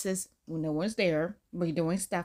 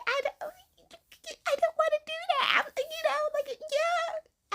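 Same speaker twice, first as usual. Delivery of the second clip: very high, slow put-on whine; imitating herself refusing in a high voice; whiny refusal; slower than usual. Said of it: "And I'm just like".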